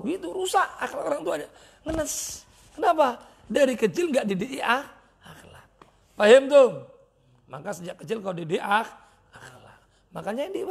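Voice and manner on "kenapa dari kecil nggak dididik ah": normal talking voice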